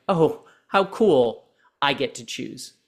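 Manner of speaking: usual speaking voice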